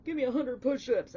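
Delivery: loud, high-pitched mock command; drill sergeant orders. Reader mimics someone ordering laps and push-ups, barking orders. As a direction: order them about like a coach at practice. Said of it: "don't think I'm picking on you about saying".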